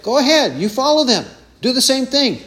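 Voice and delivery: scornful challenge; taunting. Shown as a demonstration. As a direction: goad them to follow their fathers. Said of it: "Jesus is taunting people like this".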